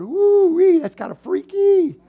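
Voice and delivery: high, drawn-out woos; mock freaked-out whooping. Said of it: "and you're like going".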